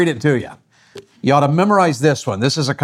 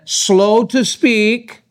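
very slow, higher-pitched verse emphasis; slowly and deliberately, pitched up; drawn-out slow; 6 semitones higher, much slower than usual. Shown as a demonstration. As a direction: stretch out each word of the phrase. Stretches out the verse's 'slow'.

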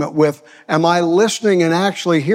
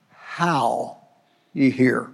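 how set apart by pauses, softer; pause, give how alone, then finish; stress on how; 3 semitones lower, 5 dB quieter, much slower than usual. Shown as a demonstration. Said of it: "I place an emphasis on that word".

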